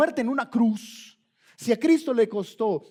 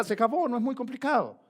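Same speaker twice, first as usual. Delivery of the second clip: normal talking voice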